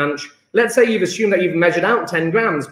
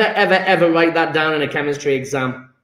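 normal talking voice

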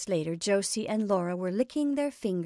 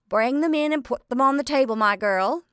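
loud, bossy cook giving orders to the maid; cook ordering; 6 semitones higher, 8 dB louder than usual. A narrator's reading. Cook commands Sadie to bring in the puffs, bossy and loud. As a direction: order the girl about. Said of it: "ordered cook".

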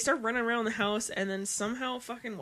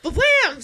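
loud, high ba-blam bang effect; crash sound effect; 11 semitones higher, 13 dB louder than usual. Retold or imitated. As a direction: shout the crash like a cartoon.

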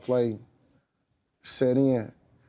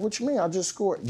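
higher, baffled protest; incredulous; 10 semitones higher than usual. Retold or imitated. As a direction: act innocent and confused. His incredulous reply.

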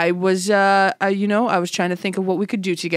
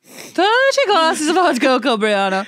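high, drawn-out mock boast; hammed-up emphasis; 9 semitones higher, 4 dB louder, slower than usual. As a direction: stretch it out, high and louder.

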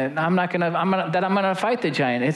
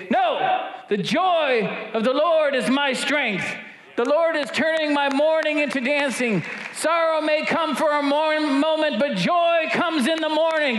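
intense declaration; 9 semitones higher than usual. Shown as a demonstration. Preacher shows how to declare scripture against depression, shouting with intensity. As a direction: hurl the words like a stone. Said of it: "chuck a stone at it with some intensity".

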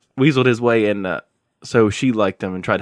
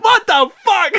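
high loud laughing disbelief; high laughing outcry; shocked what the fuck; 6 dB louder, slower than usual. Retold or imitated. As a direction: exclaim in shock. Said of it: "I said".

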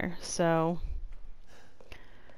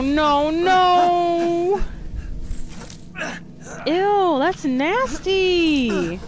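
panicked disgust; 7 semitones higher, much slower than usual. Real, not acted. Grabbed by the giant lady.

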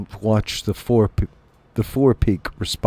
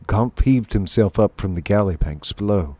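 ordinary delivery

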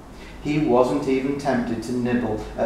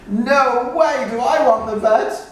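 terrified shout; 12 semitones higher, 6 dB louder than usual. Acted. Terrified goat refusing vet.

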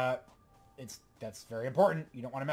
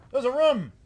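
surprised; 9 semitones higher, 6 dB louder, much slower than usual. Real, not acted. Sudden surprise in game.

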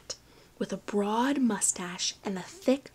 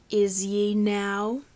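slow pirate drawl; slower than usual. Acted. Pirate captain answering Finn.

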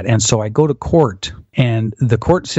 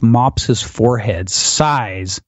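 usual speaking voice

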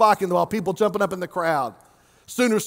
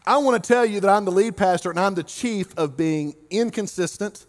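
ordinary talk

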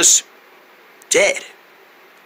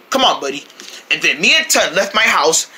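ordinary delivery, nothing marked

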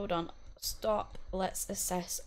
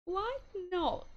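higher posh lilt on why not; 9 semitones higher than usual. Acted. Playing the game, asks her sim in a posh voice. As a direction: put on a plummy upper-class tone. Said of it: "I feel like I sounded really posh then".

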